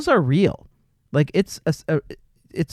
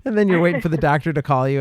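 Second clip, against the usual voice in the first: ordinary delivery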